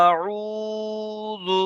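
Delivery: আউযু with the আ held one alif, slow and high; stretched vowel. Shown as a demonstration. Teacher drilling the madd. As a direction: hold the first vowel long. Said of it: "এইটা একালিপ টান দেবেন".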